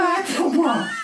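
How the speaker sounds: sugary high voice; high cute voice. Pretend. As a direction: coo it like a sweet child. Mock cute voice.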